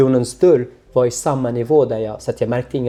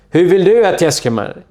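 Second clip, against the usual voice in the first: a bit louder, pressing the question; louder guard's question; 5 semitones higher, 6 dB louder than usual. Retold or imitated. Guard pressing a silent prisoner, louder. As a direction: repeat the guard's question more insistently. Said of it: "lite högre ljud".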